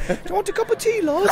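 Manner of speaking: high, hammed-up Brummie lilt on request; Brummie accent. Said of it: "Your best London accent".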